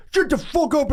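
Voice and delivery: high-pitched mock outburst; sudden high mock yell; spazzing out. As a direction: imitate an African traveler losing it. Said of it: "just started spazzing out".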